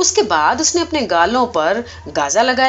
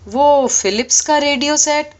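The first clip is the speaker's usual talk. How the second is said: higher, slow, knowing ask; sly drawn-out question; 6 semitones higher, 3 dB louder, slower than usual. Acted. Superintendent reminds the operator of his radio gift, slow and high. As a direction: dangle the gift slowly.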